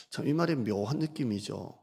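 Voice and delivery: ordinary delivery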